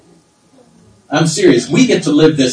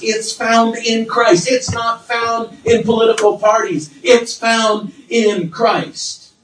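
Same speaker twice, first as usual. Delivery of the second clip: raised, insistent preaching voice; 6 semitones higher than usual. Acted. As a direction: build the conviction line by line.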